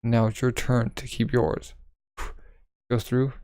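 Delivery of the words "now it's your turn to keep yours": ordinary delivery, nothing marked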